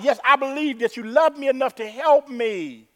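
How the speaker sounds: high, insistent acted plea; desperate believer. Dangling man affirming he is loved, acted pleading. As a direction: call the answer upward, pleading.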